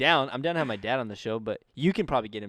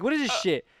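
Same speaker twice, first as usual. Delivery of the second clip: higher, a mild mock yell of a freaked-out mom; freaking-out mom; 12 semitones higher, 6 dB louder than usual. Retelling her mom finding the pen, a mock freak-out. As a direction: imitate a mom losing it over a vape pen. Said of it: "she was freaking out".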